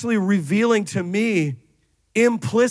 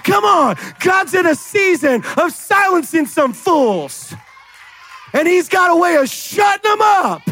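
high, driving, shouted sermon delivery; full-force preaching; 6 semitones higher, 4 dB louder than usual. Real, not acted. Preacher telling church God silences their enemies, full-force preaching. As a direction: preach it hard, climbing into a shout.

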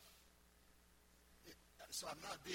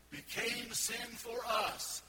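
slow, louder, every word weighed; preacher hammering the verse; slow emphatic declaration; 6 semitones higher, 6 dB louder, much slower than usual. Real, not acted. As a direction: drive each word home. Preacher punching the quoted verse.

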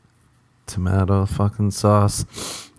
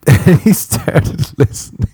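higher, words breaking into laughter; laughing while talking; 8 semitones higher, 4 dB louder, slower than usual. Real, not acted. Comedian recalling his friend with headphones on, helpless laughter. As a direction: crack up mid-sentence. Cracking up while telling the story.

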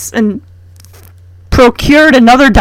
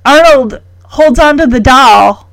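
ordinary talk, nothing marked

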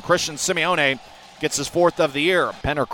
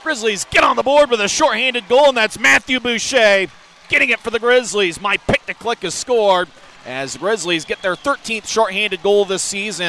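excited; 7 semitones higher, 4 dB louder than usual. Real, not acted. Celebrating goal after the call.

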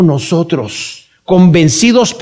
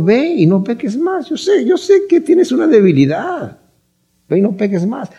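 compassionate; 5 semitones higher than usual. Acted. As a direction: send them off gently, pitched up and softened. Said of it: "Pero le dijo". (tono compasivo, agudo y suave)

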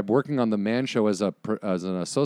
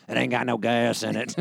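a hint of a rural Southern movie character; understated Southern character voice; Sling Blade character. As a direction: lightly quote the Sling Blade line in character. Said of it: "Billy Bob Thornton's like".

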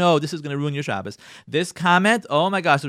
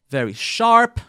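higher, louder, critical "very sharp"; sharp criticizing tone; 7 semitones higher, 7 dB louder, slower than usual. Shown as a demonstration. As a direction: make "very sharp" sound like an attack. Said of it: "sounds criticizing".

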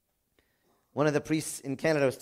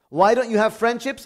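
loud high pointed question; challenging question; 7 semitones higher, 8 dB louder than usual. Real, not acted. Preacher challenging the congregation, loud pointed question. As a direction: call them out.

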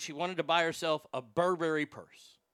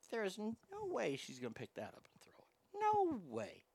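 inner voice; 4 semitones higher, 9 dB quieter than usual. Retold or imitated. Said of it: "I think in my head".

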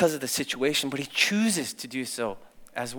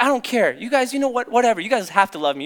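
acted dismissive outburst, higher and louder; defiant teen; 8 semitones higher, 5 dB louder, faster than usual. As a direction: brush the family off, mock defiance. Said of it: "Part of me was like".

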